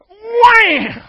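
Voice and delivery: huge, high, roaring shout; furious shout. Acted. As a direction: bellow it as he strikes. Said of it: "He probably said".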